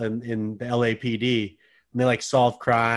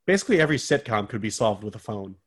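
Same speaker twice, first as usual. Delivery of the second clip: ordinary delivery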